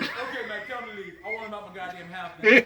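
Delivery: loud laughing imitation of a fed-up grandpa; angry grandpa. Son imitating grandpa ordering the TV man out, mock angry. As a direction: bark it like an angry old man, half laughing. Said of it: "And he goes".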